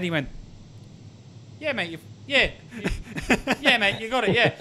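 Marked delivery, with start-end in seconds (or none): 1.6-4.5 lightly imitated London accent; a mock Londoner, broken up by laughs; big Londoner. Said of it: "big Londoner".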